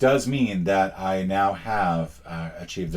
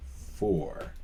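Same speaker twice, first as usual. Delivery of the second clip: ordinary talk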